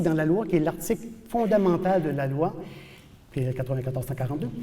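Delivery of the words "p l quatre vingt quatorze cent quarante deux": quick, softer recitation of the law's number; fast, low-key rattle of the code; rattled off fast. Shown as a demonstration. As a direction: reel it off from memory, quickly and quietly. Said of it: "j'aime ça le dire souvent".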